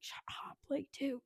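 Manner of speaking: whispered, hushing someone in danger; urgent, whispered order to be silent. Retold or imitated. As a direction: hiss it at him to keep him quiet. Rook hushing Frank in danger.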